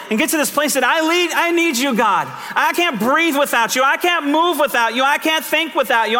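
high, full-voiced pleading prayer to God; fervent prayer. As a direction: cry out each "without you" line as a plea. Leads congregation in prayer.

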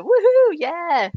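high whooping cheer for a rider; excited heckle cheer. Shown as a demonstration. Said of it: "I think I just go".

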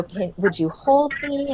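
put-on childlike client. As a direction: reassure mama like a small boy.

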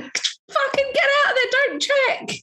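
very high, urgent yell at the screen; screaming at the screen. Retold or imitated. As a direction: shriek at the character not to go down. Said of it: "You're screaming at the screen".

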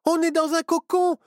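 high, loud panicked cry; high-pitched panic as the girl realises they are trapped. A narrator's reading. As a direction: Myrtille freaking out in a high voice. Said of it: "s'affola Myrtille".

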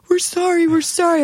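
high, pleading apology; a hint of frantic pleading; mock apologetic friends. Friends apologise in retold fight.

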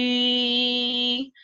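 high prolonged E; sustained vowel. Shown as a demonstration. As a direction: hold a high E. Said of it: "look at is the vowel E".

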